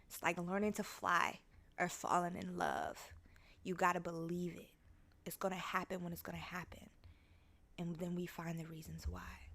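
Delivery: hushed recital fading into a whisper; soft recitation. Real, not acted. Speaker reciting a comforting song as advice, hushed to whispering. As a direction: speak it softly, dropping to a whisper. Recites lyrics softly as affirmation.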